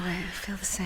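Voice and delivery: breathless whispered confession. Acted. To her lover in the chapel, a soft whisper. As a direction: whisper it close to his ear.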